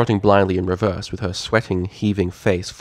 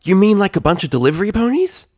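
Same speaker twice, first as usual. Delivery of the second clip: high, louder thrilled filly voice; excited filly; 12 semitones higher, 6 dB louder than usual. Acted. Scootaloo thrilled at the delivery plan, loud and high. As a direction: burst out with a bright idea.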